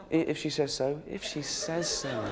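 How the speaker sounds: lightly voiced, hesitant old man; imitated old man. Sweet old man's resigned answer.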